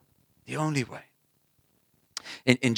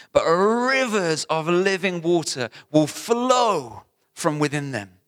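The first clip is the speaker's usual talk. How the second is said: higher, slow building proclamation; impassioned preaching; 5 semitones higher, 4 dB louder, slower than usual. Real, not acted. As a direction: lift it higher and slower as you build. Preaching climax.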